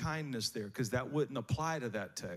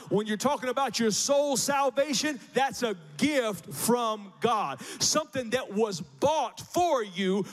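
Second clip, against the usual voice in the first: rising impassioned oratory; 5 semitones higher, 5 dB louder than usual. Acted. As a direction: preach it full force. Preaching at full force, louder.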